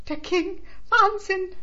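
loud starstruck squeak; high-pitched squeal. A narrator's reading. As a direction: squeal it out, a touch too high. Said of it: "quietschte sie eine Spur zu hoch".

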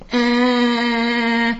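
hoher, lauter Ton; nachgeahmter Piepton. Acted. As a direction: imitiere einen Alarm. Alarmton der Maschine nachgeahmt. (nachgeahmter Warnton)

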